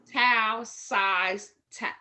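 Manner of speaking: ordinary delivery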